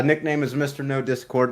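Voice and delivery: ordinary delivery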